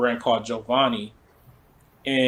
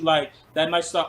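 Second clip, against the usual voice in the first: normal talking voice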